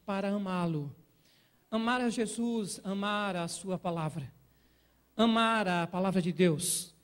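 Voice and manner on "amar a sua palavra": ordinary delivery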